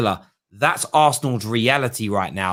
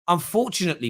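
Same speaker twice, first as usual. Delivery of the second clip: ordinary talk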